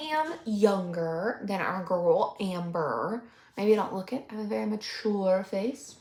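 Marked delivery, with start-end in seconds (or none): none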